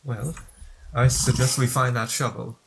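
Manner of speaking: usual speaking voice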